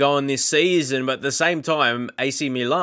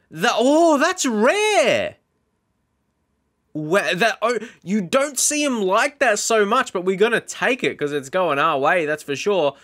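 much higher and louder, thrilled; excited surprise; 8 semitones higher, 3 dB louder than usual. Real, not acted. Shocked as the ball comes off the goalkeeper, excited. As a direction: yelp with delight at the lucky goal.

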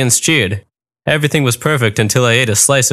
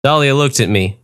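plain reading voice

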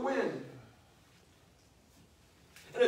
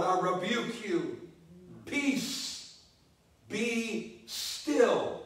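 quiet rebuke; 2 semitones lower, 4 dB quieter, much slower than usual. Acted. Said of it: "I can just see this in my mind's eye".

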